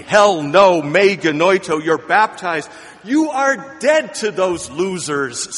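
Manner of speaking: loud, forceful preaching of the refusal; full-voiced pulpit declaration; thundering no. Real, not acted. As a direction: hammer home the emphatic no.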